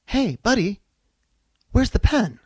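high, wounded plea; hurt feelings. Retold or imitated. Tom Cruise asking Kevin Pollak, genuinely hurt. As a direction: ask like a hurt friend. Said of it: "Like, genuinely with hurt feelings".

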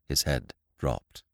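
plain reading voice

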